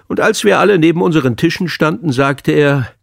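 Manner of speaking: usual speaking voice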